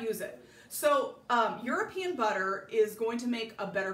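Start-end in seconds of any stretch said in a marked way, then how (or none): none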